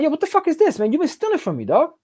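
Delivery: high, heated accusation; angry confrontation. Retold or imitated. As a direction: confront the partner who robbed you. Confronting thieving partner in hospital.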